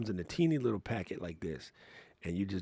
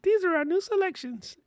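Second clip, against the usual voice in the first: chirpy, much higher robotic announcement; high robot greeter voice; 4 dB louder than usual. Retold or imitated. The café robot offering its new selections, high and robotic. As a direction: present the menu like a cheery machine. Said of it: "And they're like".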